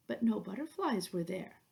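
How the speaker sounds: plain reading voice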